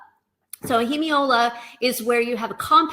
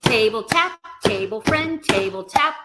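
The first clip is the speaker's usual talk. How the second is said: "table tap, table friend" chanted on the beat; rhythmic call-out; 4 dB louder than usual. Shown as a demonstration. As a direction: lead students through each move in time. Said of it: "try that with me".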